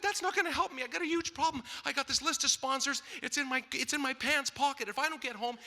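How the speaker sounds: high, rushed panic; freaking out. Retold or imitated. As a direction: spill it out in a frantic rush. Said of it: "I'm freaking".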